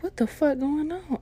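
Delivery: high, startled mock alarm. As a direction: squeak it out confused. Woken at night.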